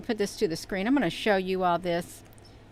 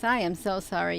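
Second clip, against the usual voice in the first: normal talking voice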